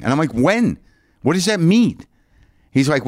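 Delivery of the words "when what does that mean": exasperated demand. Retold or imitated. Demanding answer from clerk.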